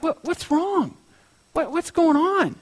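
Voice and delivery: high, soft, worried questions; concerned questioning. Retold or imitated. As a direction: coax it out of him in a high voice. Painter pleads with distraught sitter.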